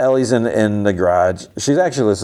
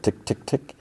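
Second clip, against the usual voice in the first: soft imitated clock ticking; hushed, pointed little ticks; quiet prompting; 3 semitones higher, 9 dB quieter than usual. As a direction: tick softly, as a nudge to start. Acting her prompting him to start.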